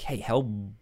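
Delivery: ordinary talk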